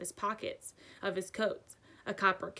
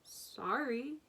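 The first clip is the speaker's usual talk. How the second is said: ordinary delivery, nothing marked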